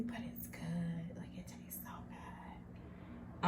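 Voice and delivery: slow whispered praise of the food. Real, not acted. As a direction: whisper it slowly, savoring.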